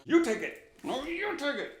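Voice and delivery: high, mock-whiny passing of the buck; hot potato squabble. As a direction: toss the blame back and forth in a high, put-on voice. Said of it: "a game of, like, hot potato".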